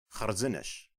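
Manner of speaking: "Kharzinish" with a rasped kh and a final ish; native pronunciation. Shown as a demonstration. Listen closely to the word for well, native-sounding. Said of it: "Just listen".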